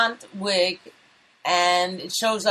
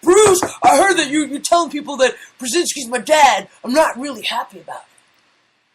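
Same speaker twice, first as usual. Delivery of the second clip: high, loud put-on complaining voice; mock-aggrieved caller, slowing down at the end; aggrieved visitor; 6 semitones higher, 5 dB louder than usual. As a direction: play someone turning up at the door to confront him. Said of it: "people that can come knocking on your door".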